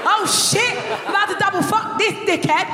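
high, frantic oh shit; freaking out under her breath; retold panic. Comic reliving panic at finding her book, high and frantic.

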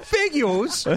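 high mock singer voice, rising at the end; dumb little voice. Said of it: "the dumb little voice she's doing".

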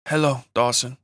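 put-on lightly Mexican-accented woman's line; a hint of a Mexican accent. A narrator's reading. Woman greets the injured man she knows well, light Mexican accent. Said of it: "in her light Mexican accent".

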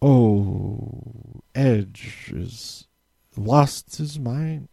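hammed-up sleepy commentary; mock soft commentary. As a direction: mimic the commentators barely speaking. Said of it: "talking very softly".

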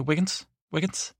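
British-style "Wiggins, Wiggins" sounding like "Waggins", short and quick; Wiggins said like wagons fast. Retold or imitated. Ray imitating how Wiggins says his name, as a quick British "Waggins". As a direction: run the two "Wiggins" together fast, almost "wagons". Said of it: "kinda like wagons, if you say wagons fast".

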